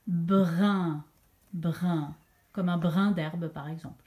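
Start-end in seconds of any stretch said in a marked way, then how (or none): 0.1-2.2 "Brun" long, low and distinct; dictated syllable. Shown as a demonstration. Teacher repeats the last syllable for pupils, drawn-out "Brun". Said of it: "écoutez-la bien".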